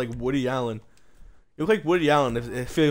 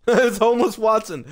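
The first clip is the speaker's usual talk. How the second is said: laughing, loud and high; laughing at the silly outfit; 7 semitones higher, 6 dB louder than usual. Real, not acted. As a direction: blurt it out through a laugh.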